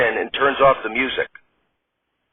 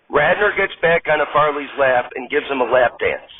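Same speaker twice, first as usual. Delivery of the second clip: ordinary delivery, nothing marked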